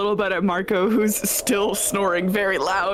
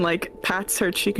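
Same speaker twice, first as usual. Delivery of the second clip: usual speaking voice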